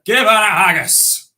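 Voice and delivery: triumphant Scots closing line; rousing finale. Shown as a demonstration. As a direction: land the last line big, like a toast.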